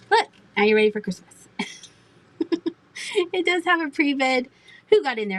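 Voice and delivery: ordinary delivery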